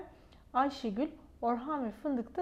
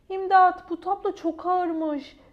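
strained cry for help; 7 semitones higher, 8 dB louder, slower than usual. Acted. Straining under heavy painting.

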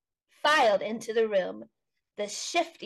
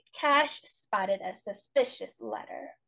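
ordinary delivery

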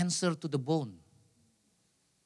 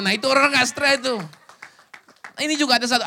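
usual speaking voice

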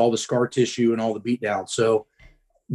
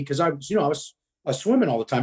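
ordinary talk

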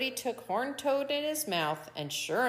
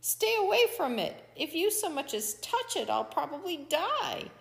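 high, panicked coyote voice; desperate cry, pitched way up; screamed warning; 9 semitones higher than usual. A narrator's reading. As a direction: warn them off in terror. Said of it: "Coyote screamed".

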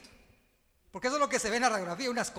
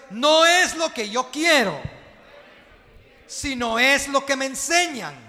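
even louder chant on the second round; repeated even louder; 4 semitones higher, 13 dB louder than usual. Shown as a demonstration. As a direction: push it harder this time so the church echoes. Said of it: "Otra vez". (otra vez, aún más fuerte, la iglesia repite)

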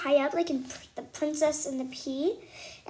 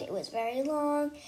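ordinary delivery

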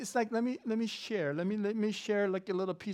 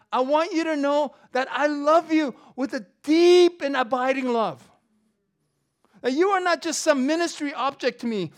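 loud, high, fervent preaching; full-force preaching; 5 semitones higher, 8 dB louder than usual. Acted. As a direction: drive Paul's words home to the congregation. Preaching Paul's love, voiced father.

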